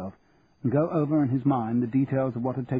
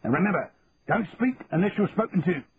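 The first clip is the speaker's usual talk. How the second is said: lightly voiced sharp sergeant; a hint of a stern warning; sharp sergeant major; 6 semitones higher than usual. A narrator's reading. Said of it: "he added sharply".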